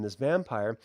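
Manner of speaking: ordinary delivery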